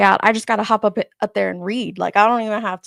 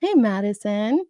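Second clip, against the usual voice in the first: ordinary talk, nothing marked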